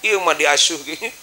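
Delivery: normal talking voice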